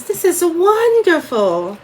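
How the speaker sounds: high, slow, delighted jolly lady voice; imitating aunt. Niece imitating her rich aunt's favorite phrase, high and delighted. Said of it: "one of my aunt's favorite things to say".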